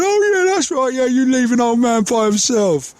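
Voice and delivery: very high, mock aggrieved yell; imitated yelling. Old man yelling after the man walking off, high mock yell. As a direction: put on an old man calling out after someone, high and stretched. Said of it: "I could hear him yelling at me from behind".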